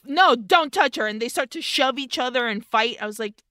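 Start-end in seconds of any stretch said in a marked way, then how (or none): 0.0-1.1 louder, higher mock yell; mock angry brothers. Host voicing the brothers fighting over her, louder. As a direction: snap it like a jealous brother. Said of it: "They're like".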